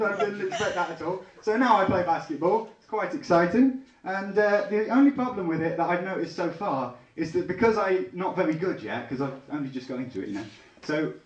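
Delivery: normal talking voice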